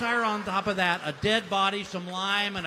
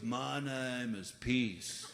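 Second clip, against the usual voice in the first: slow stoner voice; 5 semitones lower, 5 dB quieter, much slower than usual. Retold or imitated. Stoner kid voiced slowly.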